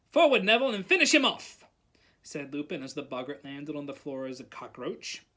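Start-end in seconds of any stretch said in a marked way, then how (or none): 0.1-1.6 high, loud rallying order; teacher's raised battle order; shouted command. A narrator's reading. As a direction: urge the pupil on with force. Teacher ordering pupil to finish boggart.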